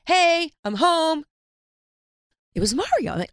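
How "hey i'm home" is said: loud, high sing-out with drawn-out words; mocking an ex's cheery homecoming holler; returning husband. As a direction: call out a breezy arrival through the house. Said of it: "I hear this voice".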